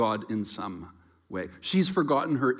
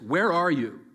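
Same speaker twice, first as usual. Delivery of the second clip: louder, higher accusing question; harsh angry where are you; stern demanding call; 4 semitones higher, 5 dB louder than usual. Shown as a demonstration. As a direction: call it out like a judge hunting the guilty. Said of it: "you hear it more like this".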